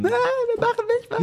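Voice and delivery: high squeaky put-on voice of the offended friend; mock whiny protest. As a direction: whine in a high mock voice, left out. Spielt die Reaktion gekränkt nach. (hohe, gespielt beleidigte Stimme des Ausgeschlossenen)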